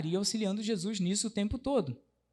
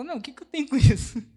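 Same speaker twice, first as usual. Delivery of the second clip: mock-indignant, higher and louder comeback; cheeky retort; 8 semitones higher, 8 dB louder, faster than usual. Teacher playing a kid brushing off his mother, mock indignant. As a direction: snap back as if bothered. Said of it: "Aí você fala".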